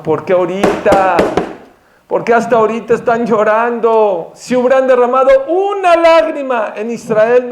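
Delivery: high, impassioned lament, building; retold tearful reproach. Yirmiyahu rebuking his weeping people, imitated lament. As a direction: reproach them as a grieving prophet, without real sobs. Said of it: "Y empezó a llorar Yirmiyahu, dijo".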